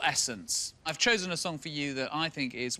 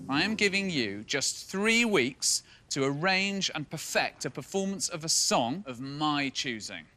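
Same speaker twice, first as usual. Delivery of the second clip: ordinary talk, nothing marked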